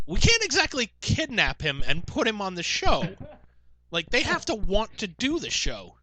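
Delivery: high, slow, laboured explaining; imitated exasperated host. Host explains to an insistent heckler, exasperated. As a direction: spell it out for a pestering heckler.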